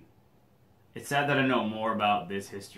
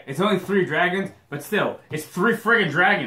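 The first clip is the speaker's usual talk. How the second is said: excited; 7 semitones higher, 7 dB louder than usual. Real, not acted. Excited about dragons.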